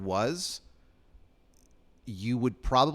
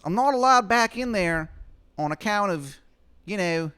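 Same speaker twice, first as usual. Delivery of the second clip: very high, loud, quick falsetto; Shithead Steve asking; 10 semitones higher, 5 dB louder than usual. Acted. Steve asking Adam for a favor, fast high falsetto. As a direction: rush the request up high. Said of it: "He goes".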